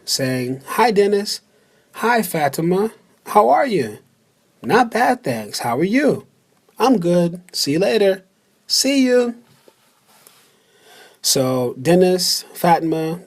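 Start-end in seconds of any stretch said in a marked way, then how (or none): 0.6-9.3 brighter, higher read of both parts; acted translation. Teacher acting out the English version, a bit higher. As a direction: play both friends greeting each other. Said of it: "two people speaking, saying".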